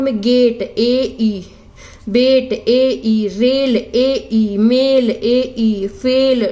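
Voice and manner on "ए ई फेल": normal talking voice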